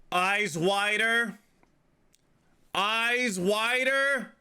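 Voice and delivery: high, slow, drawn-out photographer voice; photographer's demand. Retold or imitated. Photographer telling him to open his eyes, drawn out high. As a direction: stretch out each word up high. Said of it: "The guy taking my photo says".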